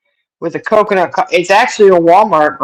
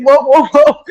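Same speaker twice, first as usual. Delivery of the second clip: high, louder, laughing alarm; alarmed whoa; 9 semitones higher, 5 dB louder than usual. Retold or imitated. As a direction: stop the cat mid-mess, half laughing. Cat knocking things off shelf.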